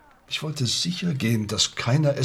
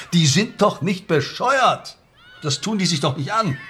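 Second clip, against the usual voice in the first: indignant scoff; 6 semitones higher, 3 dB louder than usual. Acted. Coach scoffs at foreign clubs.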